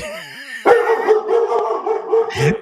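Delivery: long, high wheezing laugh; high-pitched drawn-out laughter; big laugh. Real, not acted. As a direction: crack up helplessly. Cracks up at friend's reply.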